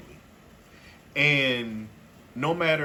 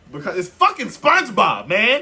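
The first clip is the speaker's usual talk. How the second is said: high, loud emphatic outburst; loud exasperation; 7 semitones higher, 10 dB louder than usual. Real, not acted. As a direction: burst out with the obvious reason. Exasperated explanation of the joke.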